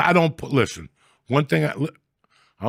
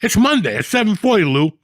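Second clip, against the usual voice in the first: much higher, loud indignant yelling; mock demand for the lieutenant; 10 semitones higher, 5 dB louder than usual. Hollering at cops for the lieutenant, high furious mock rant. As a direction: call for Lou like you own the place.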